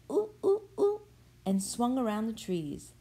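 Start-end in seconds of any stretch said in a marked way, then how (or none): 0.1-1.0 high, loud monkey hoots. A narrator's reading. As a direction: hoot like a monkey. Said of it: "They said".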